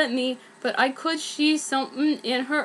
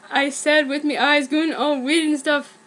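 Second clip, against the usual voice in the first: usual speaking voice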